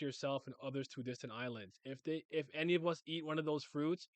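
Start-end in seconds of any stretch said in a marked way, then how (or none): none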